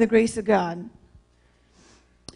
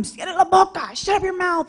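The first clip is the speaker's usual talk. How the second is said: mild retold scream; 6 semitones higher than usual. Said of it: "they were screaming".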